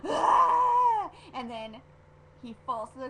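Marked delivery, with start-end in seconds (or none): 0.0-1.1 loud high mock scream; hammed-up yell of pain; acted scream. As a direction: cry out as if suddenly struck. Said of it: "he's like".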